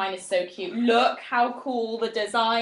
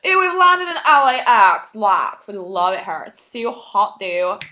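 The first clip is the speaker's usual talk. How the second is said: put-on American accent; 7 semitones lower than usual. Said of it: "I'm just like".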